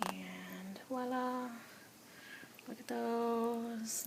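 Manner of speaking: slow, whispered voila; hushed reveal. Real, not acted. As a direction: reveal it softly and slowly.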